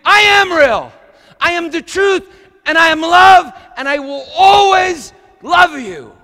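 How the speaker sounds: high, loud pleading proclamation; impassioned cry. Pretend. God crying out his love to a frightened child, loud. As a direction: cry out the promise with everything.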